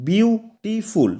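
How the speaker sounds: slow বিউ, টি, ফুল with gaps in beautiful; beautiful sounded out slowly, syllable by syllable; split into syllables. Shown as a demonstration. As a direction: break beautiful into three beats. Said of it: "না, তিনটা".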